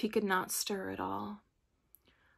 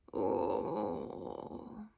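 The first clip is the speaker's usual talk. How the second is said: long, drawn-out low groan; deep groan; 4 dB quieter than usual. A narrator's reading. Unseen Tin Man groaning in the forest, deep and drawn out. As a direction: groan faintly, as from nearby woods. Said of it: "to hear a deep groan".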